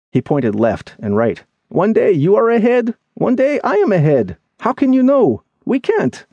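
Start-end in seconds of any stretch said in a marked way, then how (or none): 1.7-6.3 very high character voice arguing back; agitated, doubtful friend. Acted. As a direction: protest the plan in a high, insistent pitch. Voices Lemmy protesting.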